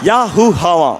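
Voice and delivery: mimicked fight yelps with swooping pitch; imitated stunt sounds. Stunt master mimicking fighters by sound alone, mock fight yelps. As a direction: swoop through short mock fight cries. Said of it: "சவுண்ட்லயே master mimicry பண்றாரு".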